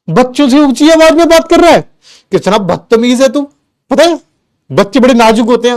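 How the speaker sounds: a hint of a stern father scolding his son. Dad scolding his son for shouting at the kids, mock stern. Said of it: "अब वो मुझे कहते हैं".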